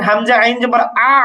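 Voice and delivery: final আ held on from mid-throat; mid-throat ain. Shown as a demonstration. Teacher repeating the ain syllable, আ sounded mid-throat. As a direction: say হামজা আইন জবর, letting the last আ continue in the throat. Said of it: "আ কিন্তু গলার মাঝখানে".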